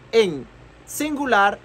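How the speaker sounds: ordinary delivery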